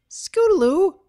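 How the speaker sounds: high squeaky gasp of a name; little filly squeak. Acted. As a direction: squeak it out, startled. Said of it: "Sweetie squeaked".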